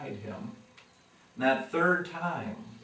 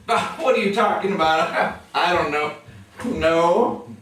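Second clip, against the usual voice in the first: nervous denial; 5 semitones higher, 9 dB louder than usual. Retold or imitated. Peter nervously denying Jesus.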